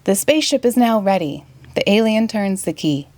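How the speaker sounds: usual speaking voice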